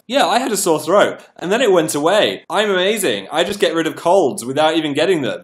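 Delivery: cocky, loud bragging; smug boast. Retold or imitated. Past self boasting to live viewers, loud and cocky. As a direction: brag about beating colds. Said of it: "I was like".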